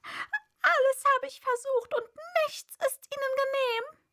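high, loud wailing lament; hammed-up tearful bird voice; lightly sobbing pigeon. A narrator's reading. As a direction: complain tearfully as a distraught pigeon. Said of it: "fuhr schluchzend fort".

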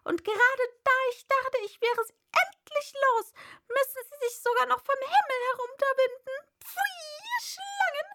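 high, loud, rushed tirade; outraged pigeon ranting. A narrator's reading. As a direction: build to a piercing cry of disgust. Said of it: "mit erhobener Stimme".

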